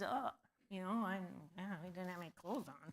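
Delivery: quiet, quick, a little sheepish mumble; sheepish admission. Acted. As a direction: mumble it like a man caught out. Man admits his nakedness to God.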